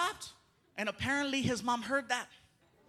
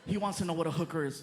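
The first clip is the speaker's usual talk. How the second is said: ordinary delivery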